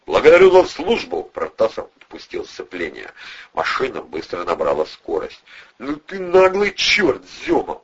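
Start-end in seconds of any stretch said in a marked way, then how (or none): 0.1-1.2 loud, high military thanks; commanding shout. A narrator's reading. Officer-style thanks shouted from car. 5.8-7.8 high, slow admiring drawl; respectful drawl. A narrator's reading. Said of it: "уважительно протянул Волына".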